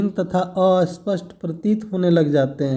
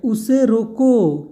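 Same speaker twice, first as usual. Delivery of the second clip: slow, higher "उसे रोको", then stop; pause after रोको; 4 semitones higher, much slower than usual. Shown as a demonstration. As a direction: draw out "रोको" and break right after it. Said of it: "सिर्फ एक कामा के प्रयोग से".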